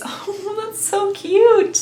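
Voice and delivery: high, slow gush of delight. Real, not acted. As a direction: coo over the cute packaging. Delighted by whale packaging.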